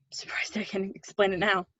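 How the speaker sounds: breathy whisper of relief. Acted. After the confession she admits it to Jim in a whisper. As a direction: let it out under your breath.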